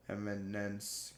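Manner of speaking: eminence said slowly and softly, each syllable drawn out; careful, slowed-down eminence; slow careful repeat. Shown as a demonstration. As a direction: slowly spell out eminence with the ear. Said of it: "repeats the word slower".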